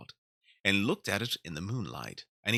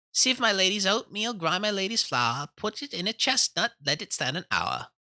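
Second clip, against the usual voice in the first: high, loud sing-song mouse voice; 11 semitones higher, 3 dB louder than usual. A narrator's reading. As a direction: chant it brightly like tiny mice. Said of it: "the little mouse voices went on again".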